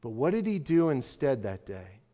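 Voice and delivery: normal talking voice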